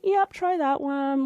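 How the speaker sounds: breezy, high and offhand; blasé friend. Retold or imitated. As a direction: shrug it off lightly. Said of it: "just so blasé about it".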